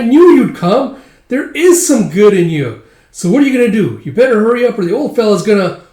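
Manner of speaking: high, loud, gushing voice; thrilled little sidekick; excited elf. Acted. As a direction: urge him on in squeaky acted relief. Elf relieved, urges Guts on.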